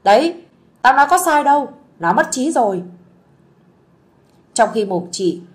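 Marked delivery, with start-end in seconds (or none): 0.0-2.8 higher, louder told-you-so; loud gloating. Acted. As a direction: crow it to the whole room. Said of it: "chị Sơn mồm bô bô".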